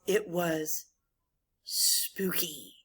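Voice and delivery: long pause, then a hushed "spooky"; suspenseful gap before a low, whispered "spooky". Shown as a demonstration. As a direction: hang after "was", then whisper "spooky". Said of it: "we use backslashes to indicate pauses".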